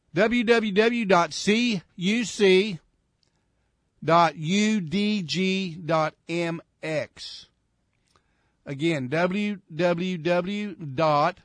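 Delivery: slow, deliberate dictation with pauses between parts; dictating slowly. Real, not acted. Radio host giving listeners the website to write down, dictating slowly. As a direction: spell it out slowly, letter by letter.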